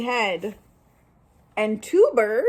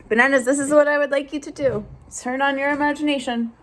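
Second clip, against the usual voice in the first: fast instructions; 3 semitones higher, much faster than usual. Real, not acted. Giving class instructions.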